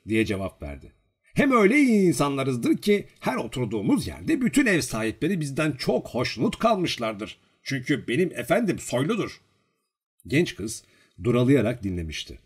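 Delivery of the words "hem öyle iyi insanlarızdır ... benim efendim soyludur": higher-pitched, self-important bragging; boastful. A narrator's reading. Servant bragging to the girl about his master, puffed up. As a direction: brag grandly about your master. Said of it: "Vanyusha böbürlenerek".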